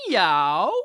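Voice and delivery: low, loud model meow; demo meow. Shown as a demonstration. Teacher modeling a meow just for Lenny. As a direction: show Lenny the meow slowly. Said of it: "just you and me".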